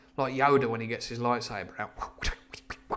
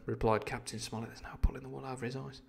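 ordinary delivery, nothing marked

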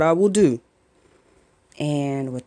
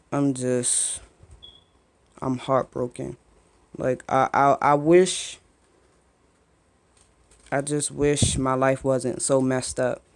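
near tears; 4 dB quieter than usual. Real, not acted. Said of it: "I'm about to cry".

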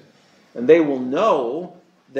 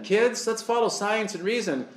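lightly mocking chipper voice to children, softer; mock Satan club leader; 5 semitones higher, 5 dB quieter than usual. Club leader coaxing the kids, mocking and soft. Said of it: "And they say that".

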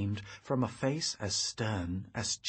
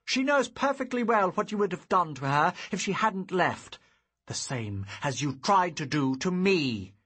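loud, high accusation; angry accusation; 8 semitones higher, 6 dB louder than usual. Acted. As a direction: hurl the charge at him. Hero accuses father.

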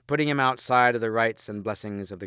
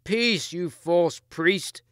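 high, slow contemptuous shout; Mordred shouting at the priest; 7 semitones higher, much slower than usual. A narrator's reading. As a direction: silence the priest with scorn. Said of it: "shouted Mordred".

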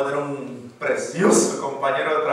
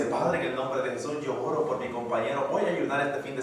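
soft, humble prayer to the Father; 3 semitones lower, 7 dB quieter than usual. Retold or imitated. Believer praying softly for a coworker. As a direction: pray gently and sincerely for him. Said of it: "si usted dice".

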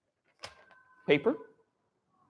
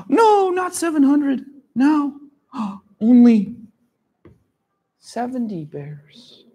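mock shock; 7 semitones higher than usual. Teacher gasps at correcting number.

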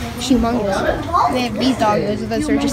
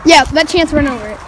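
ordinary delivery

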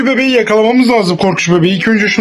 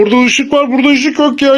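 ordinary delivery

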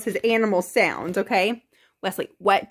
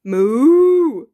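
loud, drawn-out moo; cow moo; 8 dB louder than usual. Shown as a demonstration. Parent teaching toddler cow sounds, loud moo. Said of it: "What does a cow say?".

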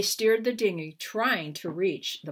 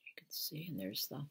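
hushed aside; 4 semitones lower, 14 dB quieter, faster than usual. Real, not acted. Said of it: "teacher pointing at picture".

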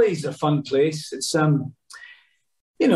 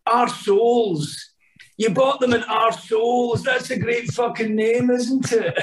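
Billy Connolly; 9 semitones higher, slower than usual. Retold or imitated. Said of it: "He went".

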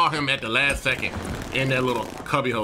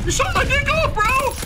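much higher and louder, incredulous; outraged yell; 5 dB louder than usual. Real, not acted. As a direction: yell it in disbelief. Shot in game, outraged laugh.

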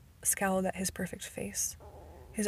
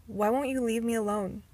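an irritated, sulky complaint; grumbling; 3 semitones higher, 4 dB louder than usual. A narrator's reading. Bella to Edward as he follows her, grumbling irritably. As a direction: grumble it at him, fed up. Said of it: "I grumbled".

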